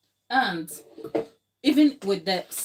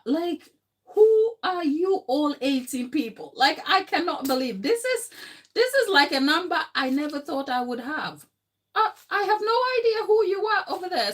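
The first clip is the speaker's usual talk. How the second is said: much higher pitched, gushing disbelief; amazed excitement; 9 semitones higher than usual. Real, not acted. Host marvels at eighteen people in the chat, high and gushing. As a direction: marvel at the viewer count, quicker at the end.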